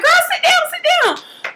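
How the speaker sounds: high-pitched, urgent mock command; squealed, hammed-up urgency; frantic order. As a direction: imitate a panicked mother ordering her daughter down. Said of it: "she going crazy".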